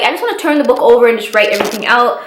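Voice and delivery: fast, breathless telling; fast panicked rush. Real, not acted. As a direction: race through it in panic. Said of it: "I'm talking too fast".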